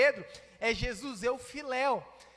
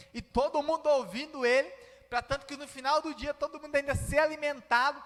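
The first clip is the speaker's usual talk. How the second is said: ordinary talk, nothing marked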